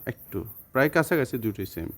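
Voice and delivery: usual speaking voice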